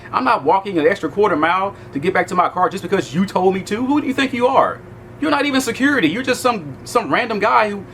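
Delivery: high, indignant retold rant; heated complaint. Complaining to a cop about the guard's order, heated retelling.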